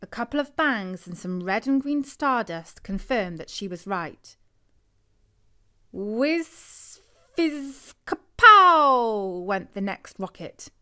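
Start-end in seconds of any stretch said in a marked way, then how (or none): 5.9-9.4 rising whiz, fizz, then loud slow kapow; firework sound effects. A narrator's reading. Narrator mimics a rocket bursting, building to a loud kapow. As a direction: act out the rocket going off.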